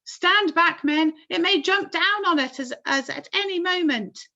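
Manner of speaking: high loud command; urgent warning. A narrator's reading. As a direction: order men back in alarm. Chief warns his men.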